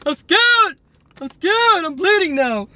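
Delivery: very high whimpering cry; hammed-up scared squeal; mock panic. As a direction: whine it like a frightened child. Pretends panic over bleeding.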